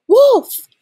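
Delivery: high, loud cry of alarm; yelling out. A narrator's reading. Boy raising a false alarm, yelling out. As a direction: yell it high, one burst per word. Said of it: "the boy yelled out again".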